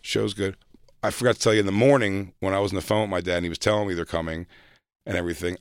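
normal talking voice

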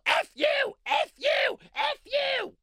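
very high, shrill-free mocking shriek of insults; mock protester yell. Mocking a protester screaming at Russians. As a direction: screech it like an angry protester caricature. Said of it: "she can yell".

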